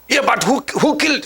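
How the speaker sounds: high, insistent neighbor demanding who killed; pushy neighbor firing questions, pitch leaping up; agitated neighbor. Retold or imitated. Neighbor presses grieving mother.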